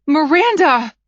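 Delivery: loud desperate cry. A narrator's reading. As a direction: cry her name out. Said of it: "I cried".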